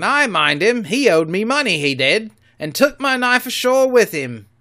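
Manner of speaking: loud rough-voiced pirate; pirate Morgan. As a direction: grumble like an old shipmate. Morgan answers.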